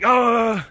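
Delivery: high, loud drawn-out "oh"; hammed-up cry of outrage; mock outraged gasp. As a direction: imitate a scandalised guard's cry. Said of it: "And then he's like".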